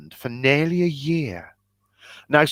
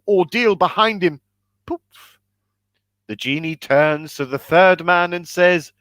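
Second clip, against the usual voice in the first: ordinary talk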